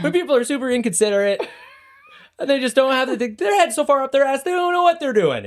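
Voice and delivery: laughing. Real, not acted. Laughing rant, pitch high.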